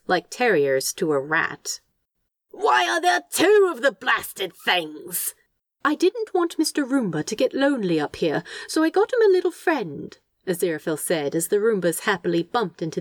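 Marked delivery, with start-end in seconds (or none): 2.5-5.3 high, slow, drawn-out complaint; aghast outrage. Acted. Demon on the ceiling above two Roombas, aghast. 5.8-10.2 high, lilting, doting tone; fond explaining. Acted. Angel explaining Mister Roomba's little friend, fondly.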